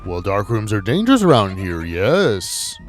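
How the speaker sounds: loud, slow, drawn-out taunting spook; hammed-up spooky threat, swelling on the last words; menacing ghost. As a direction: taunt them like a theatrical cartoon villain. Voices the ghost who lost candles.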